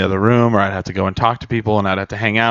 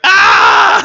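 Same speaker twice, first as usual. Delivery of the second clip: high loud startled scream; retold scream; 8 dB louder than usual. Recalling the scary game, loud high scream. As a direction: shriek like you just got jumped. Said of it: "And I was like".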